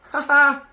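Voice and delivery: loud, high burst of laughing; joyful laugh. Real, not acted. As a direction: let out a delighted laugh.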